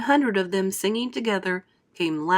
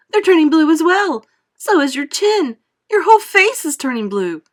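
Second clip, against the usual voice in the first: screamed; 9 semitones higher, 9 dB louder than usual. A narrator's reading. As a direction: shriek in horror at the change. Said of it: "screamed Missus Beauregard".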